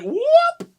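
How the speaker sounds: mock whoop. Miming a missed handshake with a high mock whoop. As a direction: yelp like a handshake just slipped away.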